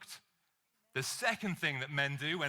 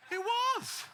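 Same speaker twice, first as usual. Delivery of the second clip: mock squeak; 4 dB louder than usual. Real, not acted. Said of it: "punchline, wallet found".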